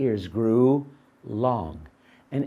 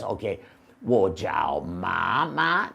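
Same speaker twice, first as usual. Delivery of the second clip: dopey donkey; 7 semitones lower, slower than usual. Acted. Donkey guessing slowly.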